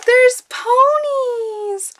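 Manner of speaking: very high, drawn-out, overjoyed squeal; thrilled squeal. Real, not acted. Vlogger discovering ponies in a package, thrilled squeal. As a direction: stretch each word in high-pitched delight.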